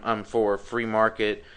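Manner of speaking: ordinary talk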